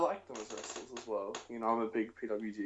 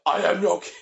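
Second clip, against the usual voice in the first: loud, high mock royal shout; roaring tyrant king; 7 semitones higher, 10 dB louder than usual. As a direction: bellow like a pompous tyrant. Imitating Barrett at airport security.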